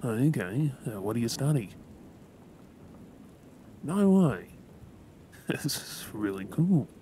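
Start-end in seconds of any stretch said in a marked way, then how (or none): 3.8-6.9 high, loud no way; genuinely impressed burst; delighted disbelief, higher and louder; surprised. Real, not acted. Impressed by what she studies.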